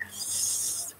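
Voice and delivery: long hissed "S" sound; drawn-out s like a snake. Shown as a demonstration. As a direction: hiss "S" out like a snake. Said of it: "S is kind of fun to say".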